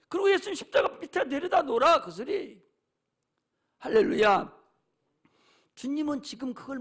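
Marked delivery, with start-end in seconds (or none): none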